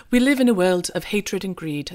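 bad British accent; lightly put-on British accent; Hugh Grant British accent. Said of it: "this terrible British accent".

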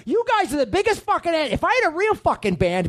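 high, exasperated tirade; angry rant. Acted. Bandleader berating his band, high angry rant.

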